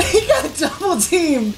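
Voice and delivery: high voice through laughter; laughing. Real, not acted. Gamer laughing as enemy gets double teamed, giggling. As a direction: say it while cracking up.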